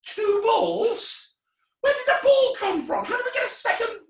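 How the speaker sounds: very high, startled squeal; hammed-up astonishment, way up high; mock shock. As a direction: shriek in put-on amazement. Second ball appears, feigned shock.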